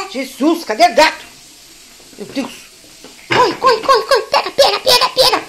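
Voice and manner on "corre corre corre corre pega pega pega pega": rising excited cheering; excited urging. Real, not acted. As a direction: cheer the pet on. Excitedly urging pet to chase.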